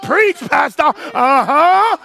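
high, hearty call-and-response cry; congregation's shout. Acted. Preacher voicing the reply he wants from listeners, high hearty shout. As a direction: whoop it up like an amen corner. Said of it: "You better say in there".

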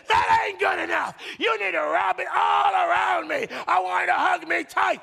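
high, loud shouted complaint; angry demand. Acted. Unsatisfied partner demands a tighter hug, angry shouting. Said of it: "and they say".

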